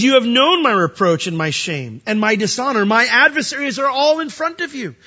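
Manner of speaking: Christ's cry from the cross. Acted. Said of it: "the Lord's thoughts himself".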